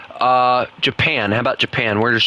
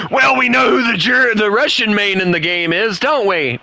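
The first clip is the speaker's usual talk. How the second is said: teasing; 10 semitones higher, 3 dB louder than usual. Real, not acted. Teasing viewer after laughing.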